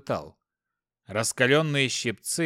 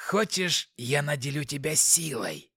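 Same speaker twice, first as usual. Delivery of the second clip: demonic whisper; 4 dB quieter than usual. Acted. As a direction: purr it like a scheming demon. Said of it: "голос демона".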